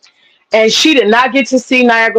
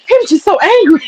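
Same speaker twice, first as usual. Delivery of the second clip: high cackling laughter through the words; can't hold the laugh in; laughing hard; 13 semitones higher, faster than usual. Real, not acted. Laughing at her own retold story to chat, high cackle.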